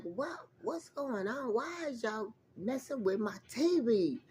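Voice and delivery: high, soft plaintive voice; mock plea. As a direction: ask them in a hushed whine. Retold complaint to spirits.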